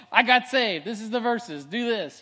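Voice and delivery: high, loud excited blurting. Retold or imitated. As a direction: blurt it out eagerly. Excited new convert blurting out.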